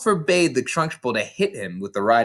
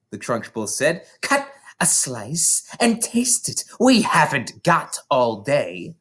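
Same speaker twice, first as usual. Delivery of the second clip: Trunchbull; 3 semitones higher than usual. Acted. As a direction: push him to eat, losing patience. Trunchbull voiced in a story.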